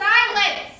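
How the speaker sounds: loud, high order; commanding shout. Acted. Ruler silencing the captive, commanding shout. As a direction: cut them off with a sharp shout.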